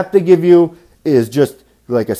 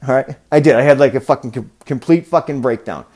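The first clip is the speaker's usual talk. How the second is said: usual speaking voice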